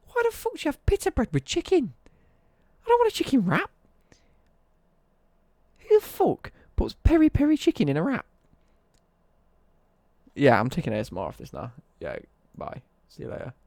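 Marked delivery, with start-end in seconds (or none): 0.1-8.2 high-pitched furious yelling; raging. Real, not acted. Ranting to chat about chicken wraps, loud furious yell. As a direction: explode at the idea of pita bread. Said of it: "I'm raging too much".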